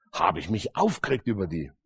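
ordinary talk, nothing marked

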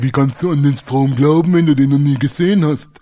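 parroting the sceptic's question back; teasing imitation of the doubter; mock challenge. Throws atheists' question back at them.

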